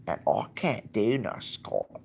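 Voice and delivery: slow Scottish attempt sliding into Cockney; failed Scottish accent. Vlogger attempting a Scot for fun, Cockney-sounding Scottish accent. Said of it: "that's like Cockney".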